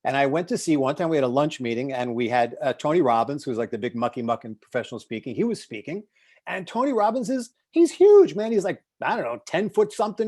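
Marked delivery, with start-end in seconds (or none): none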